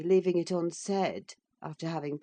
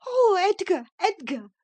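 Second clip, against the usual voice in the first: much higher and louder, breathless joy; panting with excitement; 7 dB louder than usual. A narrator's reading. Catherine throws her arms round her husband, breathless and excited. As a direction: gasp his name in a rush of joy. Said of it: "she panted".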